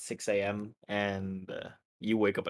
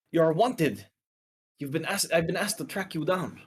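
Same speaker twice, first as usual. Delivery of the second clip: higher, firm warning; Turok declaring his task; 6 semitones higher than usual. Acted. Turok telling the dream figure he was hired, firm and raised. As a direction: lay out the bounty like a stern hunter. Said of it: "and he says".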